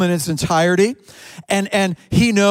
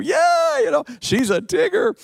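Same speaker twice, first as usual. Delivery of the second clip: excited crowd; 11 semitones higher than usual. Retold or imitated. Congregation reacting, he voices crowd.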